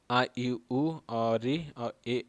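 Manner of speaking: आ, ई, उ, री, ए each stretched to three beats, very slow; long drawn vowels. Shown as a demonstration. Teacher demonstrating three-beat vowels to students, slowly drawn out. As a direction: hold every vowel long like a pluta vowel, slowly. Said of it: "जिस स्वर के उच्चारण में तीन मात्राओं का समय लगता है".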